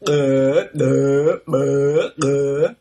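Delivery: "uh" with implosive swallowed onsets; mock dumb voice with implosives. Shown as a demonstration. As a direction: gulp the air in on each "uh". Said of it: "those are all implosives".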